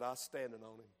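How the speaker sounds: low, soft, barely voiced; a dying boy's quiet answer; hushed last words. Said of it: "dying brother's final words".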